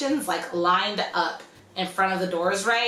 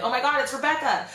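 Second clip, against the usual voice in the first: high-pitched imitation of alarmed friends; mock shocked recognition, pitched up; retold freak-out; 6 semitones higher than usual. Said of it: "they're all freaking out".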